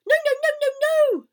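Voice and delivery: very high, loud goose shriek; panicked, rapid-fire screamed protest; frantic warning. A narrator's reading. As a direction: shriek in alarm to stop someone. Goose frantically warns the pig.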